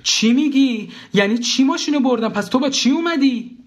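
very high, agitated wife; high-pitched nervy demand; agitated questioning. A narrator's reading. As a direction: fire off alarmed, irritated questions. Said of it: "با حالت عصبی".

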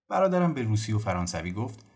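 plain reading voice

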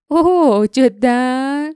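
high, loud, drawn-out mock disbelief. Jinks mocks Glock's charm with exaggerated, drawn-out disbelief. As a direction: sneer at his boast, stretching the question out slowly. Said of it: "جینکس به تمسخر گفت".

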